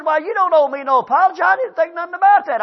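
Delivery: high, loud imitation of the clerk; dismissive clerk. Clerk to customer offering apology, high dismissive voice. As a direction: pitch it up and wave off the apology.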